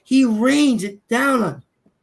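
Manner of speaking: slow, high, emphatic wonder; fervent testimony. Real, not acted. Man telling the group how God rewards helpers, fervent. Awed testimony after story.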